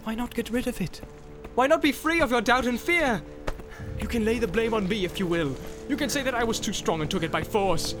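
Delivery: high-pitched, pressing wheedle; urgent, reasoning appeal; coaxing persuasion. A narrator's reading. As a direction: talk them into giving it up. Boromir coaxes Frodo to surrender it.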